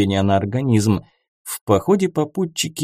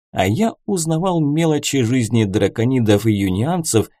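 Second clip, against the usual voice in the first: plain reading voice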